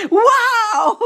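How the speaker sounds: high, loud, amazed wow. Real, not acted. Amazed at her own night.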